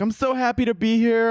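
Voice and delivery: high, loud, gushy imitation; mock gushing actress. Actress gushing in an interview, mock-perky and high-pitched. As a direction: squeal it in mock delight. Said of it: "she's like".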